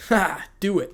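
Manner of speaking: gloating urging. Acted. Second outlaw urging on the first, gloating urging. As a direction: egg your partner on with relish.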